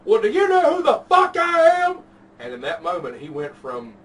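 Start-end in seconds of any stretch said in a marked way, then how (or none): 0.0-2.0 much higher and louder, a hammed-up indignant old man; crazy old man. Retelling a bar fight to friends, mocking the old man's much higher, louder outburst. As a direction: play the unhinged old guy demanding respect. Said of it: "he looks me in the eye and goes".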